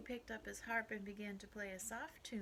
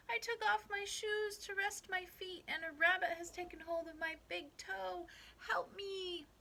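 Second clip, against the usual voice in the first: high, loud little-man voice; pleading little man; 10 semitones higher, 5 dB louder than usual. A narrator's reading. Little man with toe caught to old Pat, crying out. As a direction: plead in a tiny man's raised voice.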